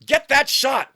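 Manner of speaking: loud, high shouted command; acted shout. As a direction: shout an urgent order, in character. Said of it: "Ars Feifel shouts".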